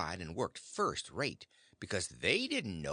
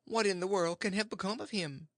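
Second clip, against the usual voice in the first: raised, fretting worry; a mild anxious aunt; worried aunt; 8 semitones higher than usual. Acted. Aunt fretting over the missing boy, raised worry. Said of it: "she says".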